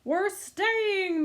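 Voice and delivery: loud, high joyful shout. A narrator's reading. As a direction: shout the good news. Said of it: "Mittens shouted".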